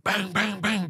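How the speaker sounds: words pitched high and punchy like knocks on glass; mock knocking. Storyteller mimics the pounding on the window, high and excited. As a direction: imitate the banging with rising pitch.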